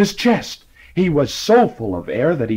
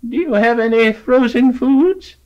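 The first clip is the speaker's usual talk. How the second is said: put-on high, thin old lady voice; old lady asking; 10 semitones higher than usual. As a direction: play a half-deaf old lady. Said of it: "said the old lady".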